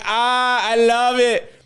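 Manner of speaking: high, slow, dazzled ah into it's so bright; squinting into bright light. Pretend. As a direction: gasp up at a blinding light, stretched out. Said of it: "squinting your eyes".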